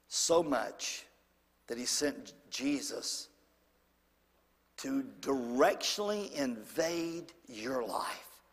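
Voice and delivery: ordinary delivery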